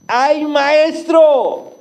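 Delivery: drawn-out high cry of dismay; mock cry. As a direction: wail it slowly, high. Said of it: "gritó". (grito lento y agudo)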